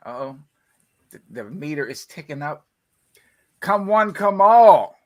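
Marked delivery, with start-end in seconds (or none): 3.6-5.0 carnival barker. Acted. Mock hyping the livestream.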